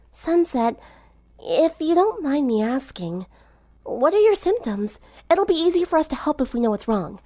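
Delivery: lightly voiced caring girl; gentle concern. A narrator's reading. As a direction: lean over a sick friend kindly. Twilight gently asks.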